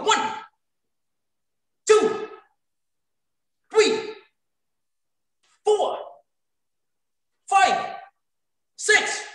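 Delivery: high, called-out count for each rep; drill count. Real, not acted. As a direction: bark the count like a coach. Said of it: "instructor counting out drill reps".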